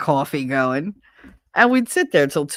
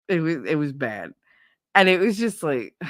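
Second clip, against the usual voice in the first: ordinary delivery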